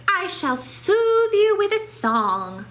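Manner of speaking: high, slow chirpy mouse voice; a little mouse offering help brightly; squeaky helpful mouse; cheerful mouse. A narrator's reading. Mouse about to sing.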